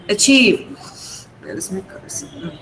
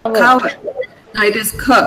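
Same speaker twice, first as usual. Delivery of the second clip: ordinary talk, nothing marked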